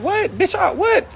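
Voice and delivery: a stunned, furious "what?"; outraged disbelief. Real, not acted. Son reliving his mother's threat, outraged high-pitched disbelief. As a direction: pitch shoots way up in shocked outrage.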